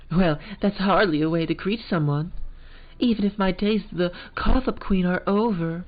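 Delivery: high, haughty posh American lady. Acted. Lunch lady offended by the girl, in posh American. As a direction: lift it up and sound haughty. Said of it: "kind of, like, posh American".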